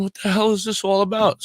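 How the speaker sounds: high, loud exasperated cry; exasperated outburst. Retold or imitated. Radio host telling boss the news is horrible, exasperated outburst. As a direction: throw up your hands in disbelief. Retelling shock at boss retiring.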